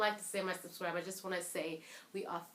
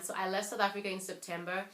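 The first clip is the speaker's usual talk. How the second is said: ordinary talk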